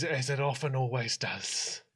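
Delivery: soft, resigned trailing voice; meek resignation; timid grandson. Acted. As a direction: say it quietly and slowly.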